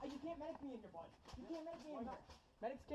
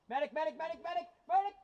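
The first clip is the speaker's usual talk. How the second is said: building repeated call for medic; man down shout; 3 semitones higher, 6 dB louder, faster than usual. Real, not acted. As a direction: call louder each time. Calling for a medic.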